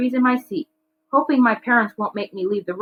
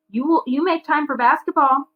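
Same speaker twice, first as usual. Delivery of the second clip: warning; 7 semitones higher than usual. A narrator's reading. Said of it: "Baba warns".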